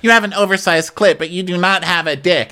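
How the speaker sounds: high-pitched mock jeer; taunting. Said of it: "taunting her now".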